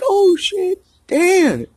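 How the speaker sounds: put-on old friend suddenly recognizing him; surprised recognition. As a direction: gasp it out as it clicks.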